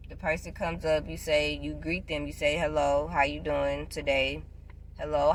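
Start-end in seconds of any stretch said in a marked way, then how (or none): none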